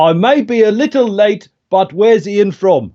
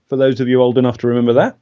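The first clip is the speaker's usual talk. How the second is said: usual speaking voice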